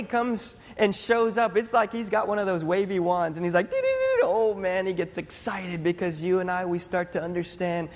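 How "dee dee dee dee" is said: very high-pitched trilled dee-dee-dee; high sing-song wand sound effect; magic wand noise. Pretend. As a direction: imitate a wand going off with high, playful dees. Imitating God waving a wand.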